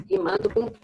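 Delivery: normal talking voice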